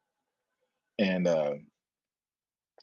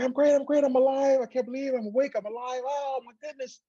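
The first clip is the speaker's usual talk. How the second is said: very high, excited squeal; overjoyed sister; 3 dB louder than usual. Retold or imitated. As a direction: gush like an ecstatic sister.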